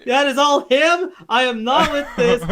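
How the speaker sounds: high, slow, deliberate refusal; indignantly disown it, spacing out the words; emphatic protest. Real, not acted. Refusing to share the blame.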